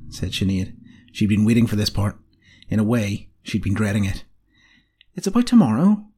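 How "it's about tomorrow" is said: high, tentative opening; unsure, pitched well up. A narrator's reading. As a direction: broach a delicate subject. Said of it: "said Roisin tentatively".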